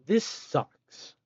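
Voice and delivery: grumbling, fed up; flat complaint of frustration; annoyed. Real, not acted. Frustrated by crafting recipe.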